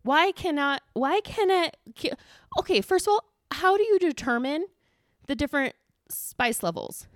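high, loud exasperation; rant, worked up and incredulous; exasperated rant. Real, not acted. Ranting about spice levels.

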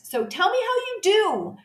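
ordinary delivery

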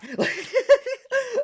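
high burst of laughter; real high-pitched laugh. Real, not acted. Laughing at his own fear in the story, high laugh.

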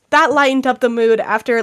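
ordinary delivery

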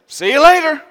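high, loud breezy goodbye; mock brush-off. Preacher joking he left the stranded man. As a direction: toss it off cheerily and walk away. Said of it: "I look at him and I say".

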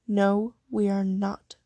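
plain reading voice

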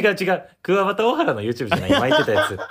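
high, loud laughing protest. Real, not acted. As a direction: laugh while correcting. Laughing correction of wrong name.

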